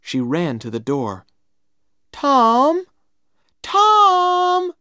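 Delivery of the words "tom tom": put-on high, loud girlish call; shouting. A narrator's reading. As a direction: shout his name after him. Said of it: "she shouted".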